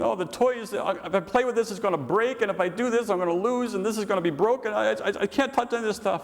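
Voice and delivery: put-on high, fretful whine of a worried boy; whiny pessimist kid. Crying pessimist boy frets over his toys, high whine. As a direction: whimper it like a kid afraid to break things. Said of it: "he's saying".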